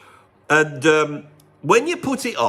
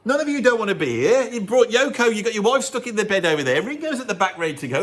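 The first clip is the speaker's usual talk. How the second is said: panicked Paul; 7 semitones higher than usual. Retold or imitated. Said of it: "He's going".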